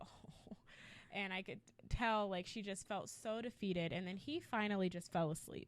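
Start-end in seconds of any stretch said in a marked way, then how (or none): 0.0-0.6 breathless whispered "Oh.". Retold or imitated. Stunned passenger reacting to the boy, whispered. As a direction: whisper it, caught off guard. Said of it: "And I'm like".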